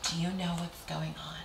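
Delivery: imitating a hushed confidante; soft, conspiratorial mock whisper; gossipy whisper. Mother gossiping with staff, acted.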